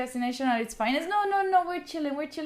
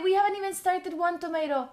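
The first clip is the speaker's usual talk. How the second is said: usual speaking voice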